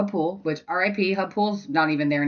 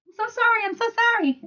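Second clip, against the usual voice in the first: much higher, cooing apology; 3 dB louder, faster than usual. Real, not acted. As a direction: coo sorry to the dog. Apologizing to dog.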